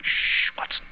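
whispered shush; hushing. Acted. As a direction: hush your companion sharply without voice. Hushing companion in ambush.

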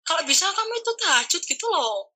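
ibu mengomel, nada naik; omelan ibu. Retold or imitated. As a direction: omeli anak yang mau ujian. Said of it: "Diomeli aku". (ibu mengomel karena anak malas sholat)

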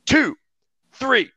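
referee's count. Retold or imitated. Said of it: "He goes".

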